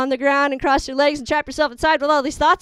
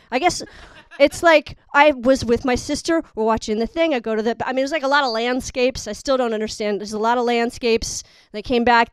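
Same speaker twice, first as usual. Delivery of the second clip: ordinary talk, nothing marked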